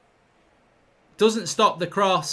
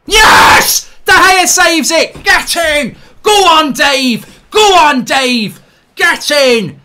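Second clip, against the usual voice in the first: screaming joy, high and loud; ecstatic celebration; 5 semitones higher, 9 dB louder, much slower than usual. Real, not acted. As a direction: roar it like the keeper just saved you. Fan celebrates penalty save.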